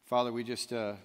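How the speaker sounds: low, soft, unhurried prayer voice. Real, not acted. Preacher leading the church in a hushed closing prayer. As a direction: pray quietly over the congregation.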